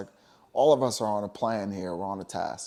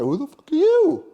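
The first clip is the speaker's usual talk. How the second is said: much higher, louder voice, defiant and furious; furious Yeshua; 12 semitones higher, 5 dB louder than usual. Retold or imitated. Yeshua confronting the temple gamblers, high furious defiant shouting. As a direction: tear into them like a prophet flipping tables. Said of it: "And he was like".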